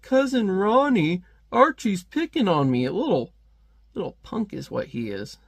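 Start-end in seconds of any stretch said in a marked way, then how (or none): 0.0-2.8 put-on much higher, slow whining kid; whiny tattling kid. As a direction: tattle to Ronnie. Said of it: "he's just like".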